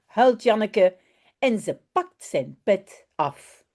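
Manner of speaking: expressive read-aloud with voiced characters; storybook narration, lively; children's story reading. A narrator's reading. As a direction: read it to a class of little ones.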